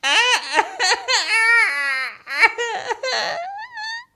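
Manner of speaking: put-on very high wailing baby cry; baby crying and screaming. Baby in the cardboard box bursting into loud cries. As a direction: wail like a screaming infant. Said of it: "begins to cry and scream".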